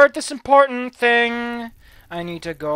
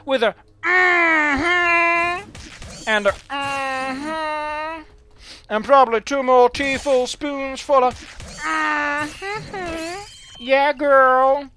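high, slow, drawn-out sassy mm-hmm; 7 semitones higher, 3 dB louder, much slower than usual. Pretend. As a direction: give it full diva attitude. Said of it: "a dose of sass".

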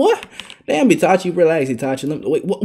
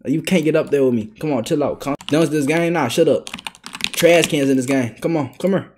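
ordinary delivery

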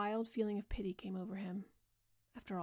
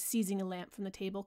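plain reading voice

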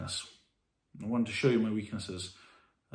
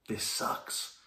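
whispered; 5 dB quieter than usual. Retold or imitated. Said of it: "saying to you".

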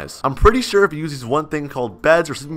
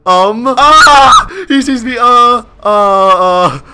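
loud, high stalling noises with a yelp; flustered panic; 4 semitones higher, 7 dB louder, much slower than usual. Real, not acted. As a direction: caught out and at a loss. Spotted by the house owners.

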